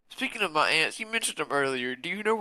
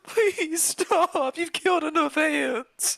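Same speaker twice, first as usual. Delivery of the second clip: pleading much higher; high anguished plea; 13 semitones higher, much slower than usual. Acted. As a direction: beg them to stop, heartbroken. Pleading for the ants.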